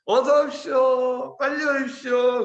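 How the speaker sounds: high, slow, sing-song greeter; smiling welcome. Retold or imitated. Gate soldiers to new recruits, smiling sing-song welcome. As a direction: imitate kindly soldiers luring recruits in with a smile. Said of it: "웃으면서 우리한테 얘기해".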